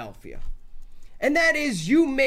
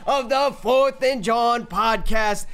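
loud, drawn-out broadcast opener; booming show intro over music; hype announcer intro; 2 semitones higher, 3 dB louder, slower than usual. Acted. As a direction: call it like a big-game announcer.

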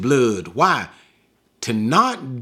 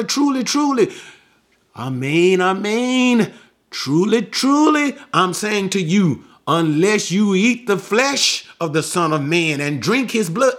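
high, ringing proclamation; emphatic Jesus; 5 semitones higher than usual. Acted. Preacher voicing Jesus' bold declaration to the crowd, high and ringing. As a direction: proclaim it boldly, pitched high. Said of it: "Jesus broke out with another".

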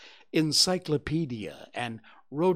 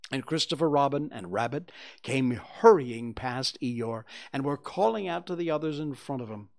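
ordinary delivery, nothing marked

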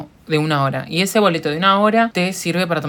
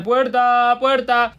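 mock call of door, higher; imitated yell to driver; 6 semitones higher, much slower than usual. Passenger calling the driver to open the door, mock yell. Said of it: "voy a tener que gritar".

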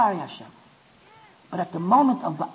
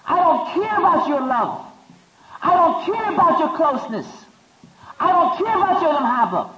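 defiant rejection; 7 semitones higher, 4 dB louder than usual. Acted. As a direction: spit out each line as a cold refusal. Voicing rejection of Hashem's love.

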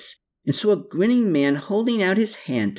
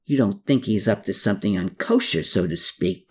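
ordinary delivery

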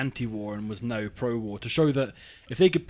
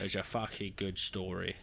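muttered echo; 4 semitones lower, 8 dB quieter than usual. Retold or imitated. Echoing the editor's line.

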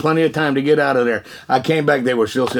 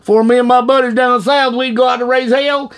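raised, louder Southern voice; Southern accent; 6 semitones higher, 4 dB louder than usual. Acted. Man from Georgia telling Wanda of his buddies, Southern accent. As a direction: imitate a Southern good old boy's accent. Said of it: "He had a southern accent".

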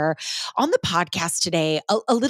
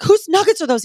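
excited; 11 semitones higher, 5 dB louder than usual. Retold or imitated. Bursting into husband's office.

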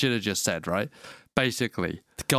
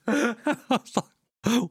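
laughing; 10 semitones higher, much slower than usual. Real, not acted. Laughing mid story.

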